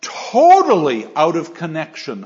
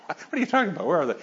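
laughing through Sancho's line; 2 semitones higher, 4 dB quieter, much faster than usual. Retold or imitated. Lecturer voicing Sancho to the class, laughing, disbelieving. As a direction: giggle through the puzzled question. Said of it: "Sancho just turns and says".

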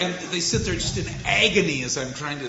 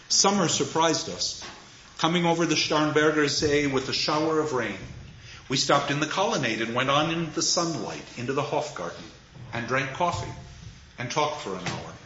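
poem reading; 4 semitones lower, 3 dB quieter than usual. Acted. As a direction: read the lines as a quiet memory. Reads poem lines.

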